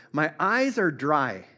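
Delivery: slow, measured recital of the lyric; gently recited song lyric, spoken not sung; reciting a chorus. Acted. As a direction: read the chorus line by line, unhurried. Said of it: "It goes like this".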